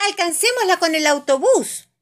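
llamada urgente, aguda y fuerte; exclamación preocupada. A narrator's reading. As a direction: propone con apuro, alzando la voz. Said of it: "exclama papá Pig preocupado". (urgencia actuada en tono alto)

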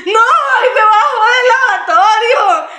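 high, loud, slow sobbing cry through laughter; hammed-up hysterical sob; mock sobbing wail. Retelling her crying fit.

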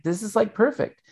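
a touch of excited glee. Retold or imitated. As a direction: hype it up lightly. His retold enthusiastic reply.

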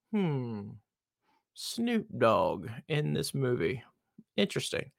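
slow, quiet musing; pondering. Retold or imitated. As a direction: mull it over slowly. Musing over the trailer.

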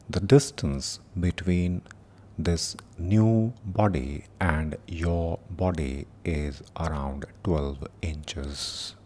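slow meditation voice with long pauses; guided meditation. Acted. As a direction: guide them gently through the body, unhurried.

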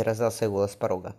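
ordinary delivery

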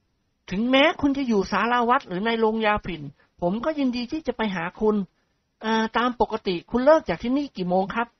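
woo her gently; gentle courting voice. Acted. Nikorn courts her softly. (เสียงชายหนุ่มพูดเอาใจ)

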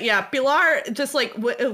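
ordinary talk, nothing marked

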